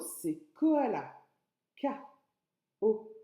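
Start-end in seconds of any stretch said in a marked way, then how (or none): 0.5-1.1 koala said slowly and clearly, ko-a-la; slow clear word. Shown as a demonstration. Teacher to child, koala said slow and clear. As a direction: spell out koala syllable by syllable. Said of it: "Le deuxième mot".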